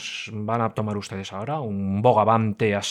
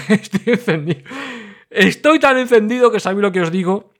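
laughing, higher and louder, worked up; fired up; 8 semitones higher, 4 dB louder than usual. Real, not acted. Host admits he's worked up, laughing and louder.

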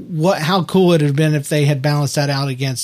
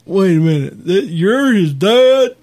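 slow, higher, drawn-out dopey realization; mock dim surprise; 3 semitones higher, 3 dB louder, slower than usual. Mocking Lucas reacting to Farkle's dad, slow dopey surprise. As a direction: play it slow and dense.

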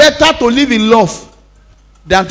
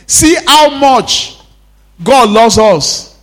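slow, higher, stretched words; slow emphatic; 4 semitones higher, 4 dB louder, much slower than usual. Acted. Pastor marvelling at God's love before church, slow. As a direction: linger on each word. Preaching slowly.

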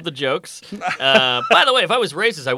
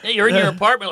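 usual speaking voice

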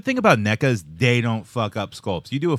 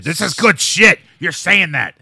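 loud, raised Vince McMahon bark; Vince McMahon impression; 5 semitones higher, 7 dB louder, slower than usual. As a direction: boom it out like a wrestling boss. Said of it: "That's what Vince McMahon".

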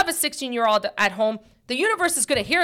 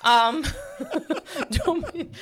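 laughing; 5 semitones higher, slower than usual. Real, not acted. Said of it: "laughs at Karen joke".

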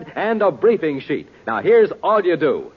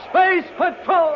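announcer shout; 11 semitones higher, slower than usual. Acted. Announcer calls out the show title.

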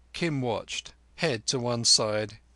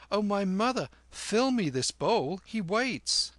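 put-on much higher, wheedling boy; pleading boy Kim; 7 semitones higher than usual. A narrator's reading. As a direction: coax the vendor like a cheeky child. Kim's boyish voice.

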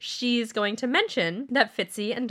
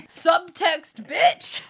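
excited shout; 7 semitones higher, 4 dB louder, much slower than usual. Real, not acted. Shouting in delight.

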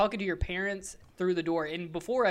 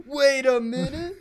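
slow, louder mock-startled quote; doubtful double-take; 6 semitones higher, 8 dB louder, slower than usual. Imitating guys at the hardware store reacting, doubtful double-take. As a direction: stretch it out high in mock disbelief.

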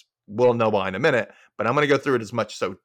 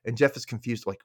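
ordinary talk, nothing marked

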